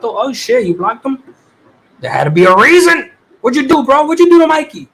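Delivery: mock interrogation of a friend, pitch climbing; excited teasing. Real, not acted. Streamer grilling a viewer over blocking Mikey, excited.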